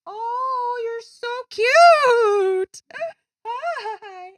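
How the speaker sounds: very high, slow cooing to a pet. Real, not acted. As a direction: coo at the cat. Talking to her cat.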